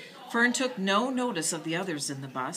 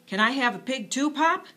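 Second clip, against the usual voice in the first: put-on eager boy; 8 semitones higher, 7 dB louder than usual. A narrator's reading. Boy asking father.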